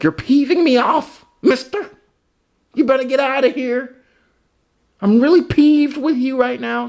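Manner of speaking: high-pitched mock 1930s indignation. Comedian mocking how someone in the thirties got peeved, high indignant put-on voice.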